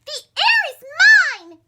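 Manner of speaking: high, loud scream. Acted. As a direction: shriek it while leaping after a butterfly. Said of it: "screamed Lydia".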